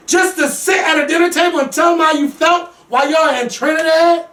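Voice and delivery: high-pitched, drawn-out outrage; incredulous rant. Real, not acted. Viewer scolding Tara for waiting thirteen years, incredulous rant.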